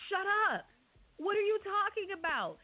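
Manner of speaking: high, exasperated shut up; annoyed dismissal. Retold or imitated. Media row reporter mocking the fighters, annoyed. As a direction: scoff at the fighters' nonsense. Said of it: "it was just".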